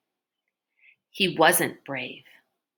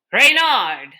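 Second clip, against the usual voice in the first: sharp prompt; 4 semitones higher, 7 dB louder than usual. A narrator's reading. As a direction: call the name out loud and firm. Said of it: "Mister Curtin prompted".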